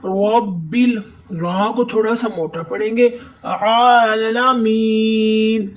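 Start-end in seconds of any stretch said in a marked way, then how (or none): 3.4-5.7 عالمین with long, slow vowels; lengthened vowels. Shown as a demonstration. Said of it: "آئین پر پھر کھڑی ہے". (عالمین کھینچ کر)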